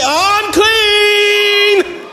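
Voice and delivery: very high, long drawn-out cry of unclean. Shown as a demonstration. Preacher modelling the leper's confession, long high wail. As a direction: wail unclean, hand over your lip. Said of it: "put your hand over your upper lip and say".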